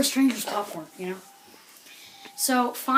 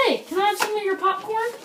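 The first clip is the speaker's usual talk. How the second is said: high, cheeky mock voice of the stranger; lightly voiced stranger begging for popcorn; pushy stranger; 10 semitones higher than usual. Stranger in front asks the teller for popcorn, high cheeky mock voice. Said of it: "and he goes".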